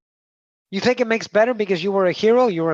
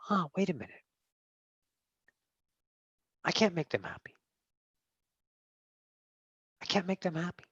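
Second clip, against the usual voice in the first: soft, halting dawning realization; hushed realization; 11 dB quieter, faster than usual. Acted. Self realizing it cannot please them, hushed. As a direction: let it sink in quietly, with long pauses.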